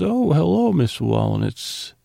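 sing-song, high-pitched hello, drawn out; put-on pleasant phone voice, swooping up then slowing; imitated phone greeting. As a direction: greet a caller with exaggerated, high sweetness. Imitating Skyler's sweet phone greeting.

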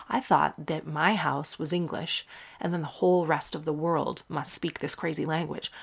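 usual speaking voice

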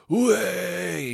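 loud, high lairy "Way!"; mock lad shout. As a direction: bellow it like a lad barging in. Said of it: "he was just like".

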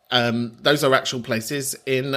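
ordinary talk, nothing marked

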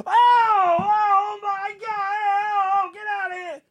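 high, loud mock scream of a crowd; retold screaming. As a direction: scream it like ten guys all at once, cracking up high. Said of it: "Like, just screaming".

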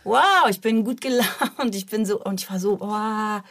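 high, loud and slow, overdone cheer; fake cheerfulness. Guest mimicking her old forced TV cheer, hammed-up and slow. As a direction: put on a bright show of being fine. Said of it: "Also hab ich immer".

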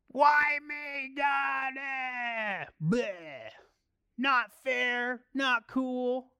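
hammed-up whine about unfairness; whiny complaint. Preacher mocking a self-pitying believer, whiny high complaint. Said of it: "Ask yourself this question instead of".

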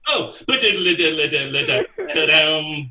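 mock drumroll with a laugh; drum-fill scat. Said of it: "and he went".

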